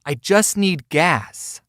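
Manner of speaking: slow stubborn repeat. Retold or imitated. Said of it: "he said".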